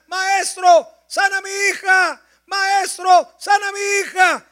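a mother's loud plea for healing; woman crying out. Acted. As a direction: cry out desperately for her daughter. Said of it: "Ella comienza a gritar".

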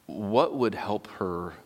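ordinary talk

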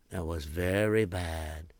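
usual speaking voice

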